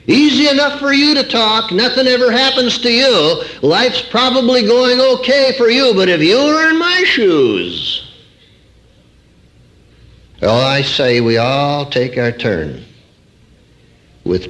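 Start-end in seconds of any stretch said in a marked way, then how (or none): 0.1-8.0 mocking grumble of a skeptic; mock complaining listener. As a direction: sneer it like a doubter who thinks you have it easy.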